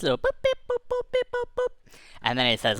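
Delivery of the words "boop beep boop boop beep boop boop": very high electronic boops and beeps; tiny high-pitched machine bleeps; button beeps. Retold or imitated. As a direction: imitate little console buttons being pushed. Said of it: "he starts pushing some buttons".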